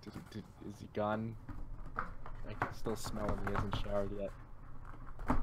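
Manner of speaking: low, soft, slow mock-secretive murmur; hushed aside. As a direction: speak under your breath as if he might hear. Pretends to talk behind someone's back.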